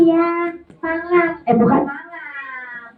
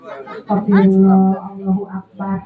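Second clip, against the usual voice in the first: ordinary delivery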